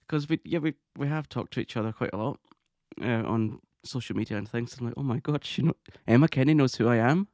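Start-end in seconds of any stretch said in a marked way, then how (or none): 5.0-7.3 loud, higher disbelieving exclamation; amazed. Retold or imitated. Fan stunned that Emma Kenny knows her, amazed. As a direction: build to a loud, incredulous finish. Retells amazement at being recognised.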